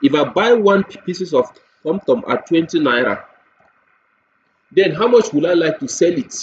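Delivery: usual speaking voice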